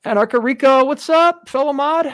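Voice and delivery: high, loud call-out to a friend; excited loud greeting. Real, not acted. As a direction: shout a happy hello across the room. Greeting a fellow mod loudly.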